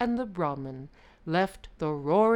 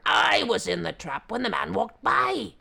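angry tiger; 3 semitones higher, 8 dB louder than usual. Acted. Tiger repeats angrily.

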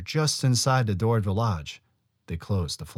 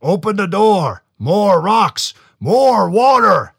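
loud, booming, slow giant shouts; giant shouting; 12 semitones higher, 12 dB louder, slower than usual. A narrator's reading. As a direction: bellow the demands from inside. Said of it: "the Chenu shouted for them again".

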